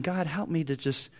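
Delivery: soft, hushed retold prayer; quiet prayer. Son quietly prays for patience with his dad. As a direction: pray it under the breath. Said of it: "I started praying".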